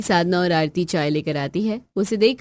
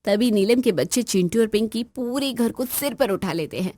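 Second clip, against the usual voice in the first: plain reading voice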